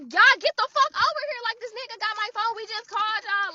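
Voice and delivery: high, loud frantic yell; yelling for the police. Retold or imitated. Calling the police over a stolen phone, high loud frantic yell. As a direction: shout down the line for help to come now.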